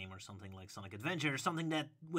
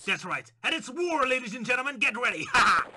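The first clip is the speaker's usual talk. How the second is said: excited shout; 9 semitones higher, 10 dB louder than usual. Acted. Hyping the fan war.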